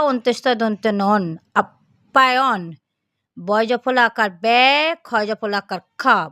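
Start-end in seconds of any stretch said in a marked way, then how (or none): 1.6-2.6 আপ্যায়ন read slowly, with the doubled p held clearly; slow careful reading. Shown as a demonstration. Teacher reading আপ্যায়ন slowly for the class. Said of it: "পটাকে double করে উচ্চারণ করব".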